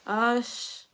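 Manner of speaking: sighing, soft teary voice; fighting the tears on camera; real, choked up; holding back tears. Real, not acted. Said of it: "I don't wanna cry".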